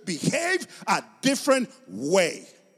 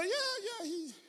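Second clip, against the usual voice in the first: high, soft mocking doubt; skeptical onlooker; 8 semitones higher, 8 dB quieter than usual. Non-Christians looking at a professed believer, mock doubt. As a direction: scoff softly in a high voice. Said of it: "They look at you and go".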